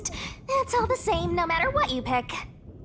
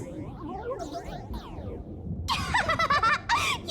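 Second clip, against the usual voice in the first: high, soft giggling laugh; high mischievous giggle; gleeful laugh; 12 semitones higher, 3 dB quieter than usual. Acted. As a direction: laugh at the silly human. Character laughs at human.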